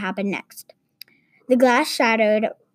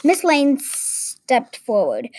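plain reading voice

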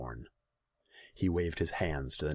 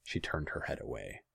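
ordinary delivery, nothing marked

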